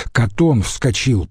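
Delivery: ordinary delivery, nothing marked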